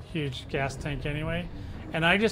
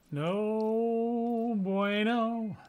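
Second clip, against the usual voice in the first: higher, very slow, stretched No bueno; drawn-out no bueno; 6 semitones higher, much slower than usual. Acted. Streamer moans about the new drive, long and high. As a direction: stretch it out like a mournful sigh. Catchphrase stretched out, mock dismay.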